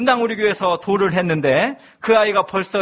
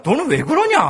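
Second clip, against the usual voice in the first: scolding; 8 semitones higher than usual. Retold or imitated. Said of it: "구박을 많이 했어요".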